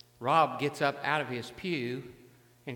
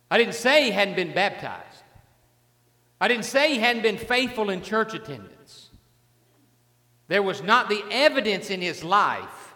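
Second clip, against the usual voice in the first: louder, driving sermon build; preaching at full force; 3 semitones higher, 5 dB louder than usual. Acted. Preacher pressing his point hard on the congregation. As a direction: pound each line home to the congregation.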